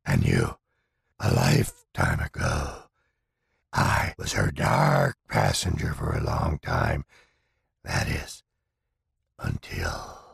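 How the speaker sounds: menacing low spirit voice; dark entity voice. Acted. The entity speaking.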